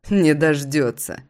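plain reading voice

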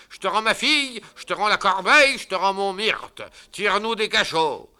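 put-on high loud grandiloquent old man; pompous father-in-law. Father-in-law forgiving his son-in-law grandly. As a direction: declaim it proudly.

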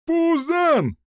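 high-pitched name-calling; yelled jeer. A narrator's reading. Prisoners yelling at the giant together, high jeer. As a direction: chant the insult high and mocking. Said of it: "заорали все узники разом".

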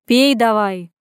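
loud, barked order; barking. A narrator's reading. Friend orders her to drink up, barking. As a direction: snap it out as an order. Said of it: "рявкнула она".

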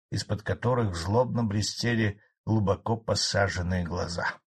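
usual speaking voice

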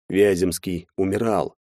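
ordinary delivery

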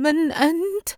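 high, trembling, terrified question; a frightened girl asks who is there; trembling with terror. A narrator's reading. Yusra asks the dark intruder in terror. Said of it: "وبنبرة متذبذبة رعبا".

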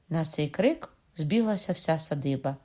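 ordinary delivery, nothing marked

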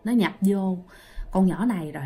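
scared hush. Retold or imitated. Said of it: "mấy đứa kia nói".

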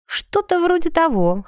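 high wry smirk. A narrator's reading. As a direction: smirk it out wryly. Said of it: "хмыкнула Эгина".

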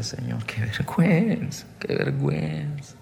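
subdued tearful lament, fading with each repeat; retold weeping prayer. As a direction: re-enact ashamed crying, softly. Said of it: "Y yo lloraba y le decía".

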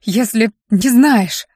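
slow, pointed sarcasm with pauses between phrases; sarcastic. A narrator's reading. Luke questioning the girl who took them, sarcastic. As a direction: needle her word by word. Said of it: "с сарказмом осведомился Люк".

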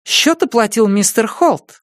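high, amused society lady; a breezy high woman's voice; lady's voice. Acted. (высокий женский голос, беззаботно)

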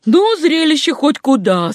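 high, emphatic remark; high-pitched marvelling; impressed exclamation. A narrator's reading. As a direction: exclaim at the sight, pitched well up. Doctor exclaims at the spectacle.

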